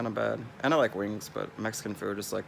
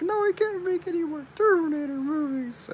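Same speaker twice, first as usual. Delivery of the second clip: mock outraged fan; 5 dB louder than usual. Reacting to Schwarzenegger as governor, high mock protest. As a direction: whine it like a cartoon fan. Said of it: "I was like".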